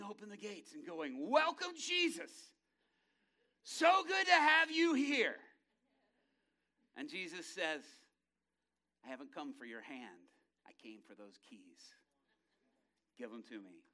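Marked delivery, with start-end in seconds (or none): 1.3-5.4 high, loud, drawn-out gleeful shout; Satan's booming, stretched-out welcome; gloating welcome. Acted. Satan gleefully throwing open gates. 9.0-13.8 low, hushed, commanding; understated, deep-pitched showdown voice; quiet authority. Acted. Jesus calmly confronts Satan, demanding keys.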